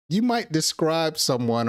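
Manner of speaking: ordinary talk, nothing marked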